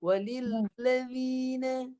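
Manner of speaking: walilladzina with a slanted, swerving dh, very slow; slow demo of the lateral dh in walilladzina; swerving sound. Shown as a demonstration. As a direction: bend the dh sideways in walilladzina. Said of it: "sehingga keluar bunyi melencong".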